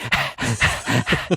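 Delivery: exaggerated out-of-breath puffing; imitated dancer panting. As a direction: mimic a winded dancer, hard quick breaths. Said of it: "dancers breathe really hard".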